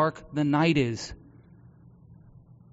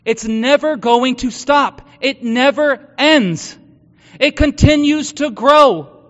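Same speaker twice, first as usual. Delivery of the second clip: loud, higher, impassioned sermon voice; preaching; 5 semitones higher, 10 dB louder than usual. Acted. Pastor telling congregation about grief and hope, preaching at full force.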